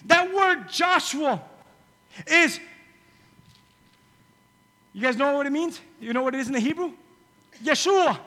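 loud, high preaching. Acted. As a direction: preach with fire. Preaching at full force.

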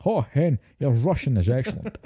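a touch of Scottish brogue with hen; lightly Scottish, teasing; Scottish Connery impression. Friend riffs on Sean Connery's line in a mild Scottish accent. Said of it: "he says it like this".

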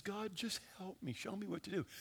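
very soft imitated prayer; hushed retold plea. Preacher voicing a desperate woman's plea to God, hushed. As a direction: plead quietly, as she did to God.

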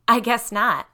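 ordinary delivery, nothing marked

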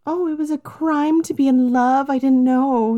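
high, innocent mock surprise; naive mock voice. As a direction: play dumb and wide-eyed.

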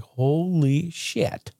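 slow, hushed holy shit; stunned awe. Retold or imitated. Vlogger holding the cash, slow stunned awe. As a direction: let it land in awe. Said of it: "I'm just like".

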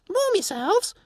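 tiny creature asking in wonder; pixie voice. Acted. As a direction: pixie asking the stranger if rain melts her. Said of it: "it said".

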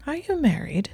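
lightly casual, hiding intent; deceptively casual. A narrator's reading. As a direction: ask it too casually. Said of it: "in a deceptively casual tone".

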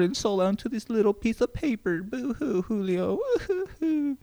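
mock sobbing, high boo-hoo; fake crying. Mocks himself for pouring heart out.

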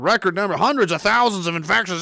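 ordinary delivery

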